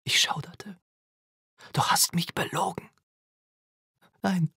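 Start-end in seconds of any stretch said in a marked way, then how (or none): 1.7-2.9 higher, whispery menace; threatening. A narrator's reading. Burning boy confronting his mother over lies, threatening. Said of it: "Seine Stimme war drohend". 4.2-4.5 high, whimpered denial; whimpering. A narrator's reading. Mother denying her son's accusation, whimpering. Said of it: "wimmerte ich".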